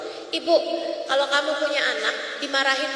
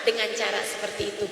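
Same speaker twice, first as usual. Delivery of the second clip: gentle exhortation; 6 semitones lower than usual. Acted. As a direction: ease them gently into the prayer. Soft closing exhortation.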